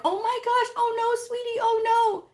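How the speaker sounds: hammed-up doting parent; mock fussing parent. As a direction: gush over the hurt kid. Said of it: "you're like".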